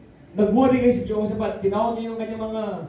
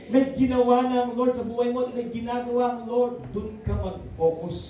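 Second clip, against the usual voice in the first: ordinary delivery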